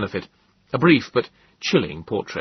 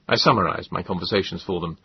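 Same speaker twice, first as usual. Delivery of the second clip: ordinary delivery, nothing marked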